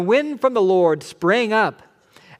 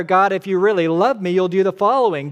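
mock demanding prayer; 4 semitones higher, 7 dB louder, faster than usual. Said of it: "You often do the prayer".

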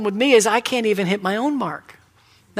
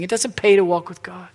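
ordinary talk

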